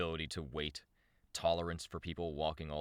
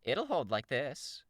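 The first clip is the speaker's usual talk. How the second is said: high, slow, drawn-out character voice; character voice, first try; 10 semitones higher, 4 dB louder, slower than usual. Acted. Reader trying out Shamrock's voice, high and slow. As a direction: pitch it well up and stretch it out. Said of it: "what was her voice?".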